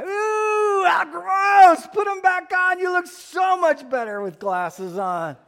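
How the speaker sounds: high, loud mock squeal of disgust; disgusted kid. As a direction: shriek it like a grossed-out child. Acting kids' reaction to his eyes.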